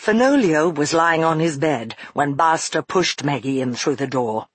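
plain reading voice

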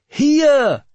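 high, loud, drawn-out call; battlefield shout. A narrator's reading. Warrior making himself visible to his men, shouting. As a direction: holler it across the fog. Warrior spreads banner, calls again.

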